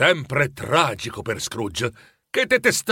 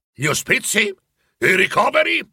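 pushing each question higher, pressing them hard; 8 semitones higher than usual. A narrator's reading. Scrooge presses the charity collectors, rising insistently. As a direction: corner the two gentlemen with quick rising questions. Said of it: "li incalzò Scrooge".

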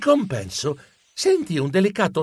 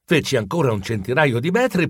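usual speaking voice

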